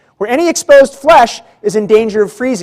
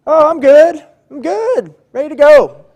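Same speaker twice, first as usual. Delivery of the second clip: forced, higher-pitched cheerfulness; faking being fine; 7 semitones higher than usual. Retold or imitated. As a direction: put on a brave chirpy front that rings false. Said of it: "I try to fake it".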